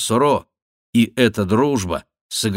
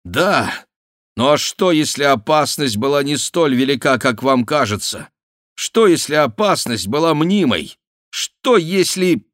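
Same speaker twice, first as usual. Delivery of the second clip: loud, raised, insistent challenge; heated outburst; 6 semitones higher, 5 dB louder than usual. A narrator's reading. Bussy loses restraint questioning the danger, heated. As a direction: build to a high, forceful break-off. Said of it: "выйдя из себя".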